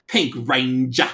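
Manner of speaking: loud, slow hammed-up Australian accent; drawn-out, exaggerated Australian accent; over-the-top Australian accent. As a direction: ham it up in broad Australian. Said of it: "Very over-the-top Australian accent".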